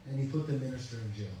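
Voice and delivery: ordinary talk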